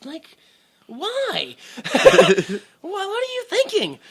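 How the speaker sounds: very high, squeaky laughing disbelief; incredulous. Retold or imitated. Watching him waste the sickles, high squeaky laughing disbelief. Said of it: "And I was like".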